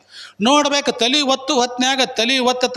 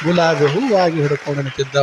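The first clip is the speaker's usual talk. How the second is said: lower, hushed wonder on ಹೂವಾಗಿ; awed repeat; 7 semitones lower than usual. A narrator's reading. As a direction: savour the miracle, pitch dropped low. (ಆಶ್ಚರ್ಯದಿಂದ ತಗ್ಗಿದ ಧ್ವನಿಯಲ್ಲಿ)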